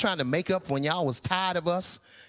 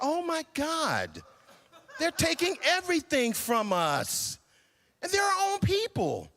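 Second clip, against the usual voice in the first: mock panic; 8 semitones higher than usual. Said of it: "I'm like".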